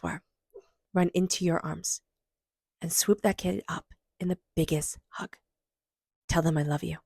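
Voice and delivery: soothing guided meditation read. Acted. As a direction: gently lead listeners to hug their inner child. Guided inner child meditation.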